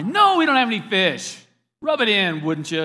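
loud, high, exasperated shout; hammed-up indignant fisherman; annoyed retort. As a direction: yell back in irritation. Annoyed fishermen answer a stranger.

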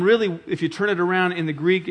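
normal talking voice

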